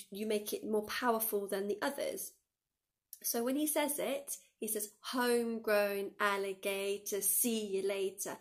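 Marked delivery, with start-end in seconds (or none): 5.0-8.4 put-on slow, punchy accents on "Homegrown alligator"; spoken with hard accents on "Homegrown" and "later"; punchy stresses. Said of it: "he kind of stresses certain words".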